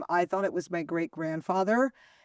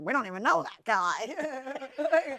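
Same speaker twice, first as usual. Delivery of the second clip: slow, higher-pitched imitation of dad; blunt dad's debunking; 5 semitones higher, much slower than usual. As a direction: drag it out like an exasperated dad. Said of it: "My dad was like".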